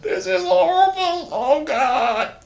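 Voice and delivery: very high, crying scream for God. Acted. Drummond sinking in the swamp, crying high screams. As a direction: wail as you're dragged under. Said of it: "the screams and cries of Drummond".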